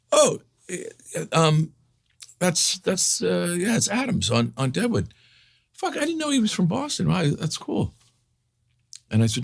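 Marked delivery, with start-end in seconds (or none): 0.1-7.9 much higher, hammed-up fumbling guess; fumbling Damon. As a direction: play a flustered friend pitching way up. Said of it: "Damon went".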